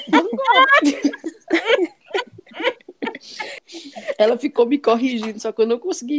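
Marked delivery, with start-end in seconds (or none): 0.1-2.0 "Van Gogh" squeaked much higher through a laugh; laughing copy. Retold or imitated. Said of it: "Aí eu disse". (imitando, rindo, bem agudo)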